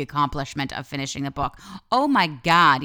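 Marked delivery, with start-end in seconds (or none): 1.9-2.8 excited exclaim. Real, not acted. Raving about finishing a book.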